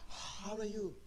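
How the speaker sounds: soft greeting on a big puff of breath. Shown as a demonstration. Preacher showing how not to greet a friend, breathing out. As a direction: exhale heavily into the words, mock-friendly. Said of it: "Do you breathe out to him and speak".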